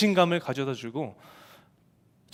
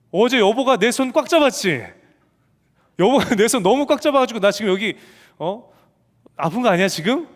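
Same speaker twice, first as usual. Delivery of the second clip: loud, higher, half-playful accusation; accusing outburst; 5 semitones higher, 6 dB louder than usual. Retold or imitated. Wife blaming husband for her sore hand, loud accusing. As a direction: blame him sharply, voice raised. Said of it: "저를 이렇게 딱 째려보는 거예요".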